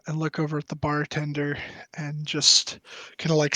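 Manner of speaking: ordinary delivery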